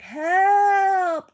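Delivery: loud high shout; desperate cry for help. A narrator's reading. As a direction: yell from above. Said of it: "came a cry".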